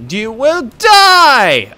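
very slow, high threat; hammed-up death threat; villain shout. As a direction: stretch "die" into a long yell.

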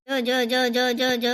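put-on low, rhythmic jo-jo to a baby; low lulling murmur of comfort; soothing hushing. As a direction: lull the crying baby, pitched low and even.

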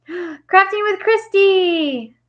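high, slow singsong shout-out; delighted welcome to a viewer; excited greeting. Real, not acted. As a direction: gasp and stretch the name out high. Greets a viewer joining the stream.

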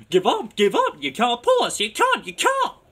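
very high, loud squealing taunt; calling trucks. A narrator's reading. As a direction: jeer shrilly like rowdy trucks. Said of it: "called the trucks".

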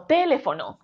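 "teléfono" wrongly stressed on TE; first syllable of "teléfono" punched. Shown as a demonstration. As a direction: hit TE hard, TÉ-le-fo-no. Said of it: "Si la sílaba tónica de esta palabra fuese te".

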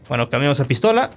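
ordinary talk

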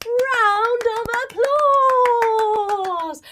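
slow, loud, high ringmaster call; big cheering announce. A narrator's reading. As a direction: stretch it like a circus ringmaster. Audience cheering.

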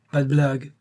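high, loud sailor's warning; exclaimed warning. A narrator's reading. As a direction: cry out a sharp warning. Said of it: "s'écria l'homme aux taches de rousseur".

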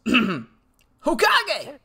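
high cartoon hero catchphrase; Naruto. Shown as a demonstration. Streamer clearing throat to find the voice, Naruto impression. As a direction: test the anime voice with one exclamation. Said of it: "I gotta do my Naruto voice".

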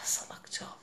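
voiceless, rueful whisper; whispered self-reproach. Real, not acted. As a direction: whisper it to yourself in regret. Scolds herself under her breath. (kendine fısıltıyla kızma)

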